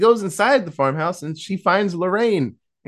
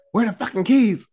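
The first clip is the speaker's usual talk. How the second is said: raised, higher mock demand; angry demand; 7 semitones higher, 3 dB louder than usual. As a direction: bark it like an impatient gunpoint order. Acts armed woman demanding keys.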